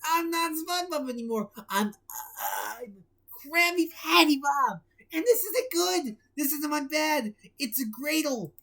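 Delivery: high, panicky cartoon sponge; panicked SpongeBob. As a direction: freak out about the new body. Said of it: "He shrieked".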